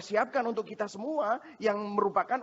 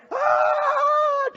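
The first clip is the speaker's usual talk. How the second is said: piercing high imitated scream of a toddler; child's scream; 10 dB louder than usual. Toddler seeing five needles, screaming child. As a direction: let out a terrified kid's shriek.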